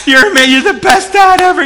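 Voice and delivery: loud, high gushing praise; hammed-up family adulation; adoring cheer. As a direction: cheer like thrilled kids greeting a hero. Acting out cheering wife and children.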